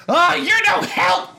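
high, loud, slow furious scream; angry scream. Acted. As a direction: scream it at him angrily. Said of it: "Chris screamed angrily".

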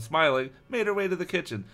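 usual speaking voice

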